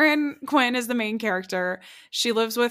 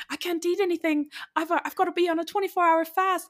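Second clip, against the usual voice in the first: put-on a weak, higher Irish accent for the schoolgirl; attempted Irish accent; 9 semitones higher than usual. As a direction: try on an Irish accent, pitched up. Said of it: "I can't do an Irish accent well".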